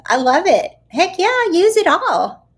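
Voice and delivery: high, loud burst of enthusiasm; excited. Real, not acted. Coach cheering on a viewer who uses it all, excited. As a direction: cheer them on with real gusto.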